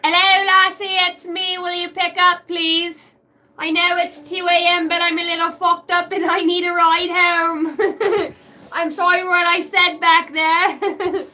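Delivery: tipsy two a.m. plea for a ride home; pleading drunk call. Pretend. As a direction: beg her to pick up, half laughing. Drunk late-night call to ex.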